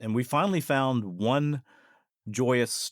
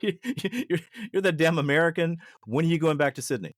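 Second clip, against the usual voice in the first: mock irritated; 6 semitones higher than usual. Local brushing off the American visitor, mock irritated. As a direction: imitate an annoyed local wanting rid of someone.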